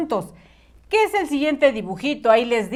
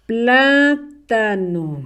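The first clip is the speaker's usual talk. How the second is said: Plátano very slow, plaaa... ta... no; syllable-by-syllable dictation; much slower than usual. Shown as a demonstration. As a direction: stretch every syllable of Plátano.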